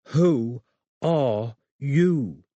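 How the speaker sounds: slow, mocking London accent. A narrator's reading. Holly to Robin, mocking her in a London accent. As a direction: drag it out in a jeering London accent. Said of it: "in a mock London accent".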